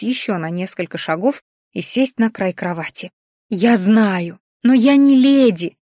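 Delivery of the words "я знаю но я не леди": louder, slow, offended cry; the heroine yells at her governess, hurt and loud; hurt outcry. A narrator's reading. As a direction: shout it out, stung and hurt. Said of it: "выкрикнула я обиженно".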